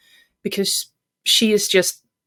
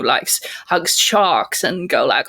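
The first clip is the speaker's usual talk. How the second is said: ordinary talk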